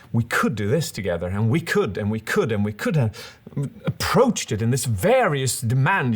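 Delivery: "could" rising and falling in a varied tune; melody change. Shown as a demonstration. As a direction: let the pitch swing on each repeated "we could". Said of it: "a melody change would sound like this".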